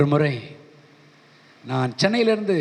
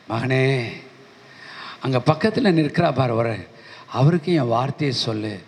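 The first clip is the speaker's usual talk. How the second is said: lower, solemn commanding voice of God; voice of the Lord; 6 semitones lower than usual. Retold or imitated. The Lord tells his servant on the train to speak, in a lower solemn voice.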